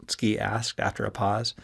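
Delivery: ordinary delivery, nothing marked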